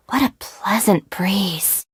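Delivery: slow, airy whisper. Acted.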